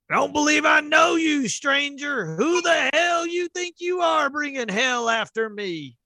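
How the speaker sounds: high shouted outlaw challenge; angry gunslinger. Acted. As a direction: snarl it like a cornered cowboy.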